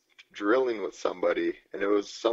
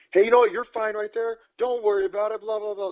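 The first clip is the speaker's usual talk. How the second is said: much higher sing-song passive-aggressive coach; mock a smug rival coach, pitched way up; sugary passive-aggressive coach voice, much higher; 13 semitones higher, 6 dB louder than usual. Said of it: "kind of passive aggressively".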